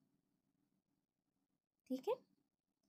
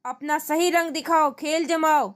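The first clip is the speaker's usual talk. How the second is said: a raised, scolding king's voice; king roaring; 6 semitones higher than usual. Acted. King scolding Tenali over the chess board, raised voice. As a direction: thunder at the clumsy move. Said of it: "राजा गरज कर".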